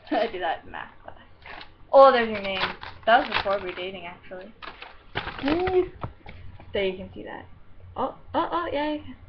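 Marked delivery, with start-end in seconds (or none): 5.4-5.9 high, drawn-out woo; playful woo. Real, not acted. Vlogger showing things to the camera, playful high woo. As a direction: let out a high little whoop.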